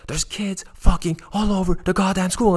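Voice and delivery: high, loud furious rant; angry drunk yell. Retold or imitated. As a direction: rage like a drunk man shouting. Drunk man ranting at school.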